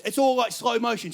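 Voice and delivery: ordinary talk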